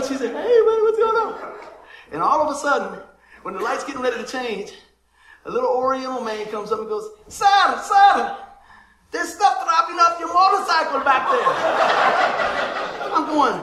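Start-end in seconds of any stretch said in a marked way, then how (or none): 0.3-1.5 high, loud, drawn-out hello; imitated neighbor greeting. Neighbor's greeting acted out from traffic. 7.3-13.1 high, loud urgent warning; imitated calling out. Stranger calls out urgent warning to rider.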